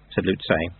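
ordinary delivery, nothing marked

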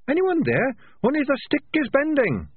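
very high, perky little rat; trapped rat calmly reporting; rat's voice. A narrator's reading. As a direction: chirp it out cheerfully despite danger. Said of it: "said the voice".

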